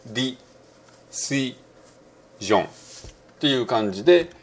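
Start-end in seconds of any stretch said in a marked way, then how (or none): 0.1-2.7 ディ, シ, ジョン said as separate Japanese beats; Japanese-style syllables. Shown as a demonstration. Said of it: "日本語っぽくやると".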